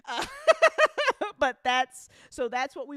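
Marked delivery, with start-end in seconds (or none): 0.3-1.3 a big high burst of laughter; sudden shrieking laugh; loud high laugh. Real, not acted.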